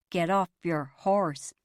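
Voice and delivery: slow cowboy drawl. Retold or imitated. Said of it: "Dad drawled".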